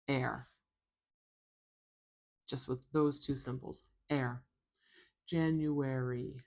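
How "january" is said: slow, careful January; slowed-down teaching repetition; slow demo. Shown as a demonstration. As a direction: say it slowly for the learner. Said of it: "E is the last sound there".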